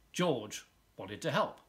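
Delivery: plain reading voice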